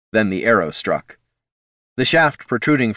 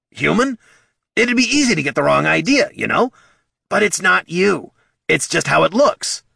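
much higher, louder fretting kobold; anxious kobold; 10 semitones higher, 4 dB louder than usual. A narrator's reading. Bert explaining to Thane how the killing looked. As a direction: babble anxiously to the troll.

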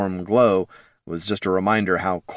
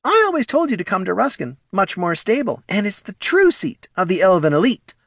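very high, prissy little voice; fussy, superior small kobold; haughty kobold Morty; 13 semitones higher than usual. Acted. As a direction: lecture primly in a tiny high voice.

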